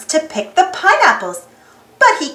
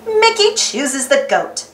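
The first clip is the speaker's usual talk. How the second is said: ordinary delivery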